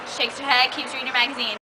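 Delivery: ordinary delivery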